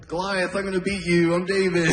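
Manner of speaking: put-on high, childish play voice; a kid narrating his toy battle. Boy Jesus playing with action figures, childish.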